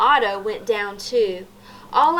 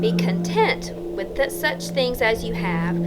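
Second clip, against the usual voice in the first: whispered verse. Real, not acted. Reads scripture.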